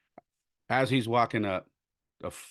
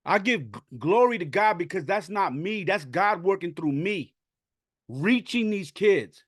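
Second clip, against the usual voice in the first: high, choked-up testimony; real tearful voice; 10 semitones higher, 3 dB louder than usual. Real, not acted. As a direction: push the words out through tears, pitched well above normal. Moved to tears, thanking God.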